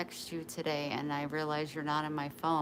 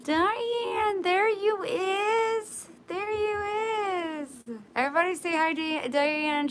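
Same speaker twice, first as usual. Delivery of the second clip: much higher, slow and loud, a sing-song hello; cutesy high greeting; 14 semitones higher, 7 dB louder, slower than usual. Acted. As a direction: call out to Diane like greeting a pet.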